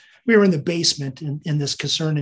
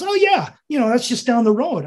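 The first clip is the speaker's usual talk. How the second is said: high mock officer voice, much higher; lightly hammed-up officer, higher; imitating the officer; 7 semitones higher, 3 dB louder than usual. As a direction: chirp it like the clueless officer. Officer's mistaken cheery reply.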